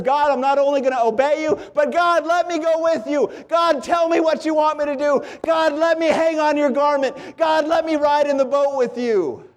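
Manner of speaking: high, fervent cry to God; preacher's impassioned prayer of excitement; excited pleading. Acted. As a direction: pile up eager pleas, pitched well up. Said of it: "Do you have that excitement that says".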